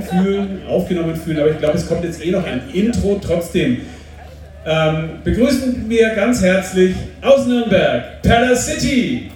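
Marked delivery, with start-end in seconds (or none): 5.2-9.3 stage announcement. Acted. Host announcing the band.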